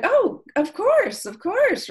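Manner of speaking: hammed-up sweet customer-service voice; eager helpful agent. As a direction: gush reassurance like a relieved helper. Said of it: "they're always just like".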